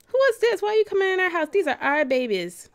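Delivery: who let you in; indignant surprise. Real, not acted. Gamer scolding a stranger Sim walking into the house.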